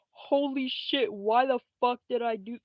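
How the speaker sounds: loud, high, dragged-out shock; horrified at what she did; shocked panic. Retold or imitated. Clara reacting to killing Susie, loud shock.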